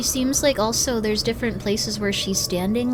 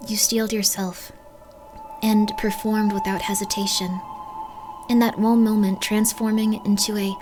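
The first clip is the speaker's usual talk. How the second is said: reading aloud; 4 dB quieter than usual. Acted. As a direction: read the game text softly. Reads game text aloud.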